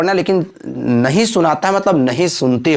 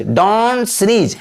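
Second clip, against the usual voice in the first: DON'T drawn out long, then sneeze; slow model phrase; 3 semitones higher, much slower than usual. Shown as a demonstration. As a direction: stretch out "don't sneeze" at half speed for learners. Teacher repeats phrase slowly for learners.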